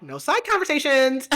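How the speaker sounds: high-pitched acted reprimand; mock scolding. Acts out moderator calling them to order.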